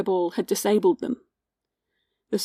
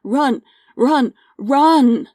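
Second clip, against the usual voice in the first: raised, higher-pitched shouts, building to the last; urgent yell; 4 semitones higher, 6 dB louder, much slower than usual. A narrator's reading. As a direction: yell it like emergency workers herding people out. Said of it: "workers yelling at us".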